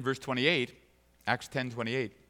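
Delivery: normal talking voice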